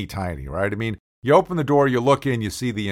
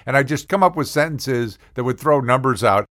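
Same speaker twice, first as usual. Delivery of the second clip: normal talking voice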